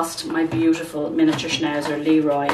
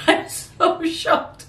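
slow, high laugh through the words; laughing in disbelief; 13 semitones higher, 4 dB louder, slower than usual. Real, not acted. Telling viewers her husband said yes, laughing in disbelief. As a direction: laugh it out, still stunned.

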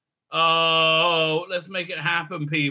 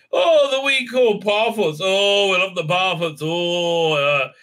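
high, louder mock Irish accent, Oh stretched; generic Irish accent; 3 semitones higher, 3 dB louder than usual. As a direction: ham up a cheesy stage-Irish harfoot. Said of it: "very generic insulting Irish accent".

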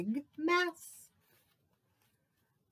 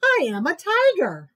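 louder, higher tiger boast; insistent loud claim; 6 semitones higher, 9 dB louder than usual. Acted. Mouse insisting to the animals, louder proud claim.

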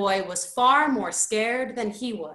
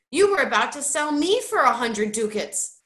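higher, louder accusation; getting angry; 4 semitones higher, 5 dB louder than usual. A narrator's reading. Bert accusing Roderick of selling him out, angry. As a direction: fling the betrayal back at him. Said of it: "getting angry".

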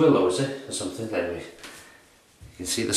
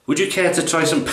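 higher posh English accent retry; corrected posh accent; 7 semitones higher, 3 dB louder, faster than usual. Shown as a demonstration. Offering soap in posh London, corrected posh accent. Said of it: "No, no, wrong accent".

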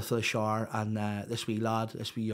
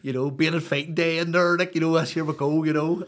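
fired up; 5 semitones higher, 6 dB louder than usual. Real, not acted. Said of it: "I was fired up".

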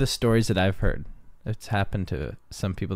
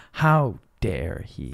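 mock indignation; much slower than usual. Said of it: "I was just like".

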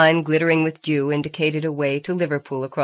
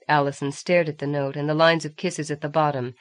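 plain reading voice

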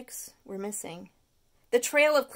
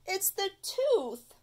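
slow, drawn-out excuse, higher; Stuart's refrain; 9 semitones higher, slower than usual. Acted. Stuart blaming his sweet tooth, slow and whiny. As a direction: blame it on the tooth, slowly.

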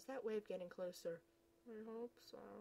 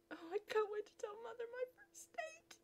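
high, breathless squealing whisper; squeaky giddy whisper; 12 semitones higher than usual. Acted. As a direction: gush about telling Mother.

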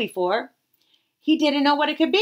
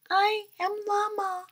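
faint echo; 11 semitones higher, 5 dB quieter than usual. Acted. Echo in nothingness.

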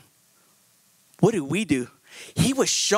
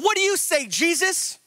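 mocking accusers; 8 semitones higher than usual. Religious men pressing Jesus.

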